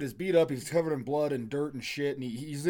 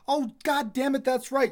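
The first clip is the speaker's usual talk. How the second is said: sudden realization; 10 semitones higher, 5 dB louder than usual. Retold or imitated. Dad realizes his mistake.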